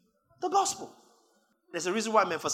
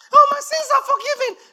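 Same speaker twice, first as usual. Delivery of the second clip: much higher and louder, with a laugh; preacher mocking a complacent believer, high and laughing; 14 dB louder than usual. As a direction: mimic a smug believer in a high squeal.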